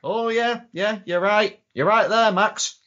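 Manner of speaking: high-pitched acted agreement; imitated dawning agreement; mock conceding.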